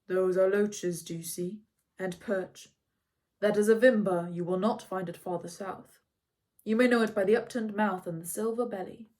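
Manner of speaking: lightly voiced wise centaur; Chiron's voice. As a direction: speak as the calm old centaur. Chiron teaching from the bank.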